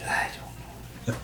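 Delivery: slow whispered shrug; whispered trailing doubt; whispered aside. Real, not acted. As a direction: breathe it out under the breath. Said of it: "trailing off".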